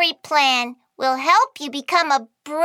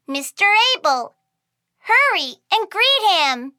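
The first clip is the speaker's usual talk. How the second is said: usual speaking voice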